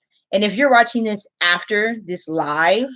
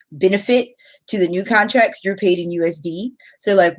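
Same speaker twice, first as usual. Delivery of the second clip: ordinary delivery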